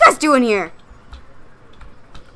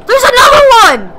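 alarmed shout; 10 semitones higher, 9 dB louder than usual. Real, not acted. Spots second monster.

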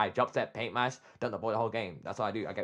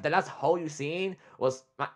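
ordinary talk

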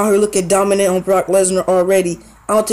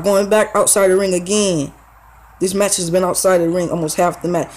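usual speaking voice

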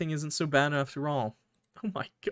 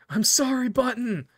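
higher, louder sorry; anguished cry; 9 semitones higher, 3 dB louder than usual. Acted. Player crying out to Button while cutting, high anguished. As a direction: cry out her name in grief.

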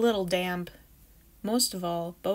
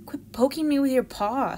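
put-on cranky kid; 6 semitones higher, 7 dB louder than usual. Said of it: "Sam told Fern".

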